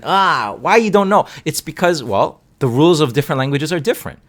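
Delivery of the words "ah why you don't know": high, loud mock exasperation; hammed-up exasperated teacher; mock scolding. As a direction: pretend to scold a student for not knowing. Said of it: "They're not wrong as in".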